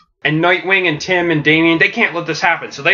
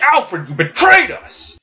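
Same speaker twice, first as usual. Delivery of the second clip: high, slow outraged accusation; Damian, pissed off; 10 semitones higher, 3 dB louder than usual. Acted. Damian confronting Alfred over the trap, pissed off. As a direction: stretch out the accusation in hot anger. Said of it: "he's all pissed off".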